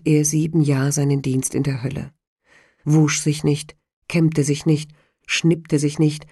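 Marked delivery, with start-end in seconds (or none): none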